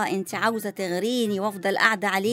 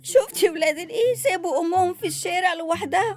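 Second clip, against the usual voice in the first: tearful, higher and louder lament; crying; 6 semitones higher, 4 dB louder than usual. Acted. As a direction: sob out the complaint about ungrateful children. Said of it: "مالك بتعيطي ليه؟". (عياط بصوت عالي ونبرة أعلى)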